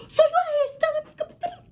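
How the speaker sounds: very high squeaky mock outrage; hammed-up falsetto scolding; mom going crazy, imitated. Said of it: "she went crazy".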